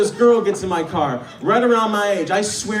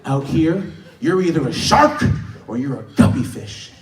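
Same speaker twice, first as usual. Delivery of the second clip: 低沉地稍稍模仿一个说教的乘客; lecturing guy, lightly voiced; 8 semitones lower than usual. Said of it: "He's like".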